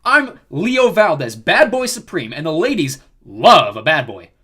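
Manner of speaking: loud, drawn-out showman's introduction; swaggering brag. A narrator's reading. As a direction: introduce yourself as the star. Leo boasting of himself.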